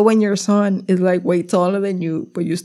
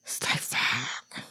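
slow breathy whispered curse; whispering; 9 semitones lower, 14 dB quieter, much slower than usual. Real, not acted. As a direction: whisper it under your breath. Venting about anxiety.

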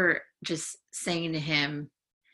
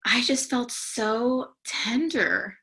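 slow and higher, voice close to tears; tender, emotional; 6 semitones higher, slower than usual. Real, not acted. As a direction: let the feeling catch in your throat as you remember. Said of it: "I'm even feeling emotional and tender as I'm recalling".

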